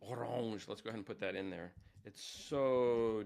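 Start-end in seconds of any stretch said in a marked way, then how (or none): 0.0-0.7 orange as "oh-RAHNZH"; French pronunciation. Shown as a demonstration. Teacher attempting the French word for orange, French pronunciation. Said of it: "and the French word".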